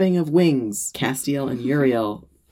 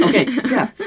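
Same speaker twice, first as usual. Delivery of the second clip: alarmed; 9 semitones higher, 3 dB louder than usual. Retold or imitated. Sudden alarm, laughing.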